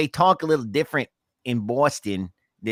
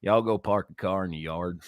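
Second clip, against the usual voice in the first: Kentucky accent; 3 semitones lower than usual. Shown as a demonstration. Co-host contrasts Boston with home, Kentucky accent. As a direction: say it like a Kentucky local. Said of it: "here in Kentucky, it's".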